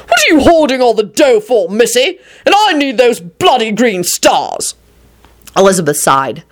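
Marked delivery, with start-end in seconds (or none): 0.1-4.8 mildly outraged, raised-pitch demand; bellowed. A narrator's reading. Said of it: "Richard bellowed".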